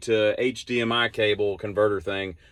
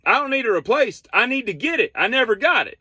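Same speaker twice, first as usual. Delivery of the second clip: high-pitched retold customer protest; exasperated protest; 11 semitones higher than usual. Customer correcting Henry about the replacement, high exasperated protest. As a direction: push back in a high, fed-up voice.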